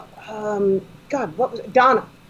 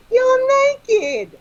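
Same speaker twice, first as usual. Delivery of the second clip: high-pitched shriek of shocked discovery; mock-scandalized high exclamation; Catherine Tate; 4 dB louder than usual. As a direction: cry it out high, stretched and comically appalled. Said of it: "Catherine Tate says".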